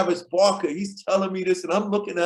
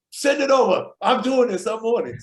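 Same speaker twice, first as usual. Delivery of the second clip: high, loud burst of imitated enthusiasm; eager retold acceptance; 5 semitones higher, 4 dB louder than usual. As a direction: jump in as if instantly sold. Acts out Duff's eager reply.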